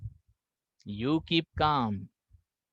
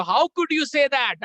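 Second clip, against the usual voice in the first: high, slow mock yell; hammed-up angry retort; mock shout; 9 semitones higher, 4 dB louder, much slower than usual. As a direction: shout it back. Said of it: "वो भी चिल्ला रहा है".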